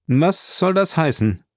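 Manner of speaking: mildly imperious challenge. A narrator's reading. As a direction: demand an answer like a master. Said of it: "entgegnete des Grafen gebieterische Stimme".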